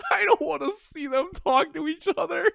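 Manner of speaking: very high-pitched mock-crying whine; mock whining. Player dreading the two characters talking, high mock sobbing. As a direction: whine it as if about to cry, hammed up.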